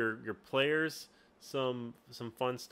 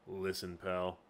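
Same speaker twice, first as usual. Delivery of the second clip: lower, softer tough-guy voice; Zinc voice; 7 semitones lower, 5 dB quieter than usual. Shown as a demonstration. Game master retries mercenary Zinc's voice, low and soft. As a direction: drop it low and quiet. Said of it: "no, not that kind of voice".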